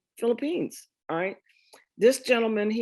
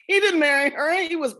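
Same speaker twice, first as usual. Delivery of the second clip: higher and louder, a laughing outrage; indignant protest; 8 semitones higher, 5 dB louder than usual. Real, not acted. Correcting the ancestry record to the audience, indignant and loud. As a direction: insist he married Granny, not her.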